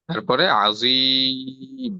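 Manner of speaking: আজিম said very slowly, the জি stretched long; drawn-out demo. Shown as a demonstration. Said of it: "আজিম শব্দটার মধ্যে আমরা কোথায় টান দিচ্ছি". (আজিম ধীরে টেনে উচ্চারণ)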